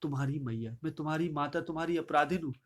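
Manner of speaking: usual speaking voice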